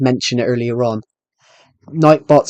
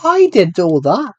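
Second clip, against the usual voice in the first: high indignant; 6 semitones higher, 4 dB louder, slower than usual. Retold or imitated. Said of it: "I'm like".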